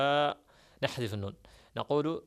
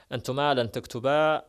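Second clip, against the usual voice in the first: تكتبا said loudly with the final ا clear, no نون; loud model reading of تكتبا; تكتبا spoken out with the نون dropped; stressed example; 6 dB louder than usual. Shown as a demonstration. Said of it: "أقول".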